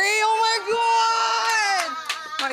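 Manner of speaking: high, loud hammed-up cry; mock wail. Pretending her therapist's death is great trauma, a loud fake wail.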